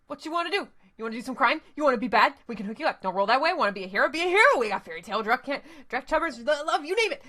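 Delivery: put-on fast hustler's pitch in a loose Australian accent; vaguely Australian accent. Street dealer pitching crimes to runners, in a rushed Australian accent. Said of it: "in a vaguely Australian accent".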